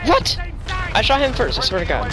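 high indignant protest; outraged at losing the duel; raging gamer. Real, not acted. Died in a gunfight.

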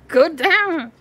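high and loud, a sharp command; firm call to cat. Real, not acted. Telling the cat on her chair to get down, high and loud.

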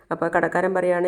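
usual speaking voice

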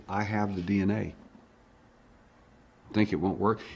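normal talking voice

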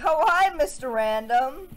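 mock-hearty, loud, drawn-out greeting; high, loud put-on hello; imitating brother's greeting. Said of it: "he'd be like".

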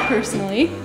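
usual speaking voice

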